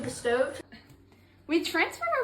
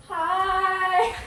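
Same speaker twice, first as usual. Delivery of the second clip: bright, sung-out hi; excited greeting; 7 semitones higher, 7 dB louder than usual. Acted. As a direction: sing the hello. Cheerful greeting to the audience.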